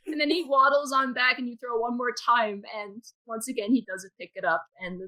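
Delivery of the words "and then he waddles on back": usual speaking voice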